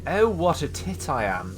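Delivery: a lightly plummy posh English self-rebuke. Acted. Gamer scolding himself in a mock posh English voice. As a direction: put on a touch of upper-class English. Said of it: "I said that in such a posh voice".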